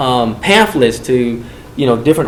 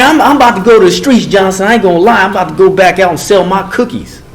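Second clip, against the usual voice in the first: worked-up declaration; 6 semitones higher, 5 dB louder, faster than usual. Retold or imitated. Inmate declaring he'll return to streets.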